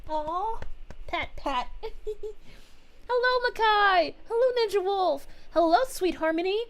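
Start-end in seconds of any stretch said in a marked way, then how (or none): none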